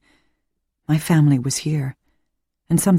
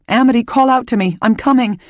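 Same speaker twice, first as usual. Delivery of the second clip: urgent shouting; 8 semitones higher than usual. A narrator's reading. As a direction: call out quickly as you run. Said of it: "I shouted".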